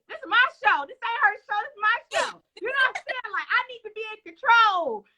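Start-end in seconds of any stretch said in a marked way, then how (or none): 0.1-2.3 higher, mock-possessive insistence; mock bossy host. Host mocks herself guarding her show, playful bossiness. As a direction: stake your claim, half joking. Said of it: "I'm over here like".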